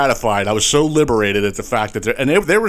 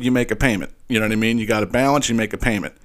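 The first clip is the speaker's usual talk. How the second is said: ordinary talk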